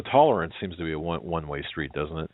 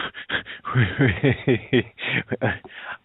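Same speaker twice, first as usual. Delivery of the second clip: helpless high giggling laugh; real laughter; 4 semitones higher than usual. Real, not acted. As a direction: burst into a run of giggles you can't explain. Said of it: "I don't know why I'm laughing".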